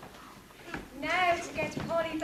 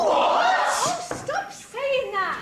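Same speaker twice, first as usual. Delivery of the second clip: exasperated; 5 semitones higher, 8 dB louder, much slower than usual. Acted. Dame irritated at repeated what.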